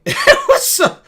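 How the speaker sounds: high-pitched, breaking into laughter; laughing. Real, not acted. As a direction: laugh through the words. Laughs telling how depressing it was.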